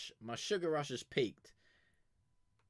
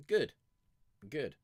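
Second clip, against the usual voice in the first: ordinary talk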